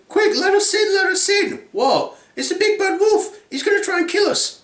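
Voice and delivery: very high, shouting pig voices; panicked piglets. Acted. Two little pigs begging their brother to let them in, shrill panic. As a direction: squeal for help in a panic.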